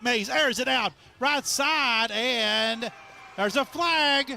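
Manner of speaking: high, loud excited play call; man down shout. Real, not acted. Live call of deep pass.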